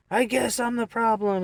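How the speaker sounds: high, loud put-on protest; mock his complaint in a strained high pitch; aggrieved man. Raging man snaps back at the driver, aggrieved. Acts out the raging man's reply.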